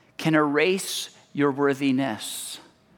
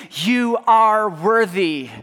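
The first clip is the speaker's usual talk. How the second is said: louder and higher, slow proclamation; booming declaration; 6 semitones higher, 5 dB louder, slower than usual. Acted. God shouting worth over the noise, slow thunderous declaration. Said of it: "shouts through all of the noise".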